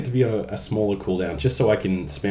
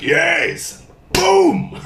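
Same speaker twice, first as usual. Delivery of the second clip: excited boom; 8 semitones higher, 6 dB louder than usual. Retold or imitated. Imitating a Reinhardt charge hit.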